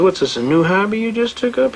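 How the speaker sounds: teasing mock inquiry from the bench; mocking judge. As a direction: imitate a judge needling a lawyer. Acts out flippant judge mocking prosecutor.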